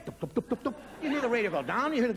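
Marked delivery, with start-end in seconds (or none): 0.0-0.7 soft "dup" footsteps, evenly repeated; imitated footsteps. Listener on the phone hears them walk away, imitated footsteps. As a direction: mimic someone padding away. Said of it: "you hear them walk away".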